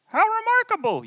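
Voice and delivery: very high squeaky mock question; mock questioner. Pastor mimicking a congregant in a squeaky high voice. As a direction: squeak it like a silly listener. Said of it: "you ask".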